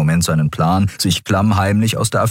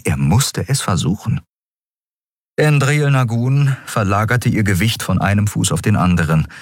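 usual speaking voice

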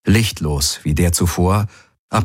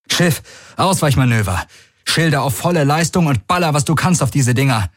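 higher-pitched, urgent battle orders; urgent command; 8 semitones higher than usual. A narrator's reading. Galen orders the ship into evasive manoeuvres, urgent command. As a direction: fire off orders with raised pitch.

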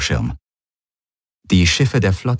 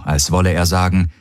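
ordinary delivery

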